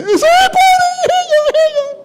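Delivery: very high, slow shouted cry; frantic shout. Retold or imitated. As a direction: shriek it out, pointing her out. Alguien grita señalando a la mujer. (grito agudo y lento señalándola)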